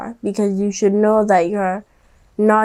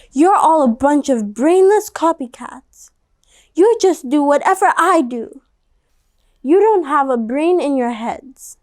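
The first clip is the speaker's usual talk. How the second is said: put-on higher, sneering child's taunt that ends in a whisper; mock them with scorn; snooty little girl insult; scornful girl; 5 semitones higher than usual. A narrator's reading. Stephanie insults copycats.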